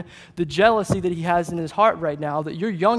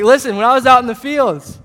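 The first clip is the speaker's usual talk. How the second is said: higher, louder, insistent retelling; emphatic young challenger; 4 semitones higher, 8 dB louder than usual. Acted. David pleading his case to King Saul, emphatic and loud. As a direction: punch it out as a playful acted plea.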